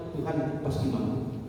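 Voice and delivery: ordinary talk, nothing marked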